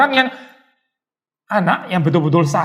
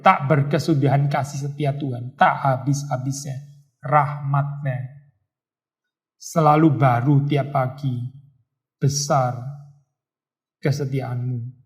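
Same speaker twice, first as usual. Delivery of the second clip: lower, softer, slow reverent reading; solemn scripture reading; 5 semitones lower, 6 dB quieter, slower than usual. Acted. Pastor reading Lamentations to the congregation, reverent. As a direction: read the verse gently, savouring each word.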